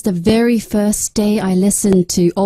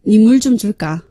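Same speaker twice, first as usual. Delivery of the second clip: louder Busan-accented intonation demo; Busan dialect; 4 dB louder, much faster than usual. Shown as a demonstration. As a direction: say it in a punchy Busan lilt. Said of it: "Busan dialect".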